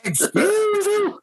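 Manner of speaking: high, slow, affronted excuse you; offended woman. Retold or imitated. Mimicking the insulted woman at the bar, high and slow. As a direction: play the insulted woman drawing it out.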